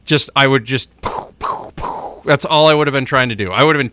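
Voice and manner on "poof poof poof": breathy whispered bat-crack noises; soft whispered poof. Pretend. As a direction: puff out little home-run sounds. Imitating home-run bat sounds.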